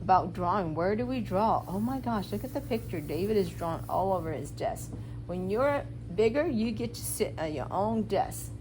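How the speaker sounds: ordinary talk, nothing marked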